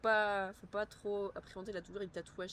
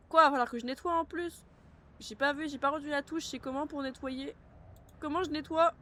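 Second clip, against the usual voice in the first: high, loud, flustered disbelief; flustered outrage; 7 semitones higher, 6 dB louder than usual. Real, not acted. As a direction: caught off guard by a chore. Flustered at game chore.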